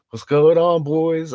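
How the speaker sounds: loud, high, cheery cop greeting; imitating a friendly cop calling out; mock cop voice. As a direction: hail them like a jovial cop. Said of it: "they was all like".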